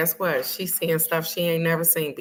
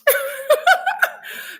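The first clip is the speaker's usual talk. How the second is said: high laugh. Real, not acted. Telling viewers the Mexico story, bursting into laughter. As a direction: crack up at the memory.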